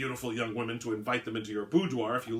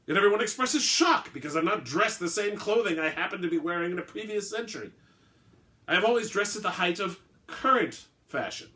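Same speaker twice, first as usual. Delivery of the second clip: higher, louder, affronted aristocratic vampire; indignant old vampire; 6 semitones higher, 7 dB louder than usual. Acted. Vampire scoffing at his hunter over a drink, higher and louder. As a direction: puff up like an offended old count.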